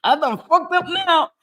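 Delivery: 3 realizing you messed up, half laughing; 1 mock panic. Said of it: "I was like".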